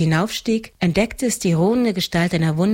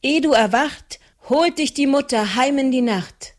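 much higher, pressing spell chant; urgent warning chanted in a high voice; stronger, more urgent; 8 semitones higher than usual. A narrator's reading. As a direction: insist on the charm, pushing harder. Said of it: "Stärker und eindringlicher fuhr Maren fort".

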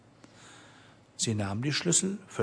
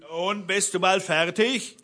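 raised-pitch impatient call; calling up from below; 7 semitones higher than usual. A narrator's reading. Bluebeard calls up to his wife from below, pitched-up call. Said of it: "Da rief der Blaubart unten".

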